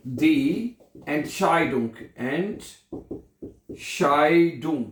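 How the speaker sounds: very slow drawn-out word; slow dictation. Shown as a demonstration. Teacher dictating die Entscheidung slowly. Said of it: "क्या बनेगा?".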